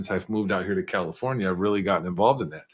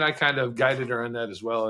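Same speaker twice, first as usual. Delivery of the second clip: ordinary delivery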